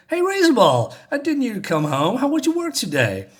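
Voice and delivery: very high, sing-song hello; exaggerated high-pitched welcome home; put-on friendly greeting. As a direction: greet the housebot way up high, suddenly all sweet. Family greets robot, suddenly friendly.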